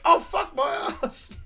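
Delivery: high, drawn-out mock yell; hammed-up cry of pain with a laugh in it. Retelling Peter having something pulled from his leg, hammed-up pained yell. Acting a movie character in pain.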